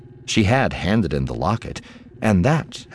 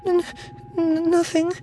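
put-on tiny high child voice, stammering; nervous stammer. A narrator's reading. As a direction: stumble on the first sound like a caught little girl. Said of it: "she stammered".

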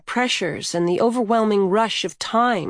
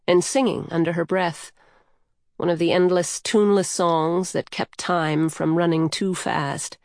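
plain reading voice

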